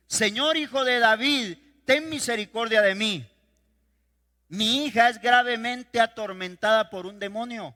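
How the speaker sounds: a mother crying out to Jesus for her daughter. A narrator's reading. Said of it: "clamaba diciendo". (la mujer clama a Jesús, fuerte y suplicante)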